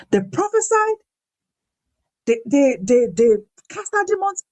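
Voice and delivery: high, pleading disbelief; incredulous protest. Acted. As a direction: argue back with God in shock.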